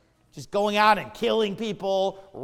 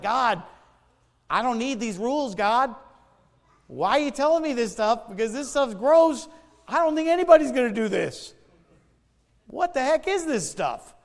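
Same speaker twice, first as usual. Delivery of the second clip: put-on incredulous kid; 4 semitones higher than usual. Recalls his nine-year-old self reading Leviticus.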